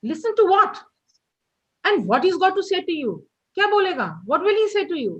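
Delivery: a fed-up mother firing back questions; very upset. Acted. Mother rejects Derry's wish to visit, upset. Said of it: "very upset, very annoyed".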